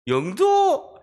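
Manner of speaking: high, loud incredulous echo; mock disbelief. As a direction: exclaim it in exaggerated disbelief, pitch shooting up. Classmate's mocking disbelief at hometown. (어이없다는 듯 높게 되묻기)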